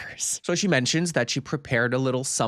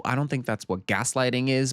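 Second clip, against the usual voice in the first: ordinary talk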